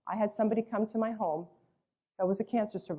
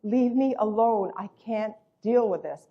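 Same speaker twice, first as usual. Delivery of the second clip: slow, louder mock yell; drawn-out, exasperated retold outburst; 2 semitones higher, 6 dB louder, slower than usual. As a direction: imitate a worn-out mother yelling. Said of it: "she's yelling at them".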